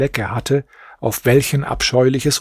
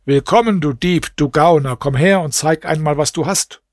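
plain reading voice

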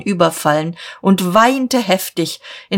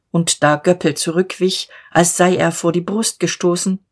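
ordinary delivery

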